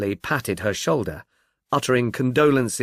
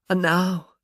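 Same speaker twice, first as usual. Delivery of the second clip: grieving murmur; 6 semitones higher than usual. Acted. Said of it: "she murmured".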